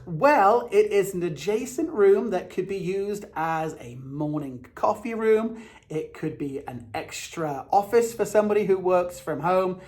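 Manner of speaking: ordinary delivery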